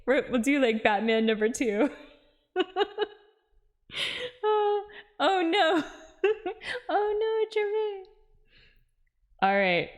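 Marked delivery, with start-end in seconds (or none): 2.6-8.0 high laughing oh no; giggling in dismay. Real, not acted.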